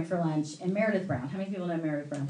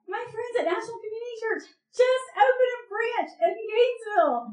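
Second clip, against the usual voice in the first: very high excited mimicry; thrilled friend; 13 semitones higher than usual. Friend tells man of new church, squealing excitement. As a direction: squeal the news in mock delight.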